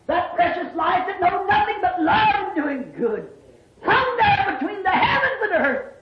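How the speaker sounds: high, swelling preacher's voice; impassioned sermon cry; fervent preaching. Real, not acted. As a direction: lift it high and ring it out. Preaches at rising force.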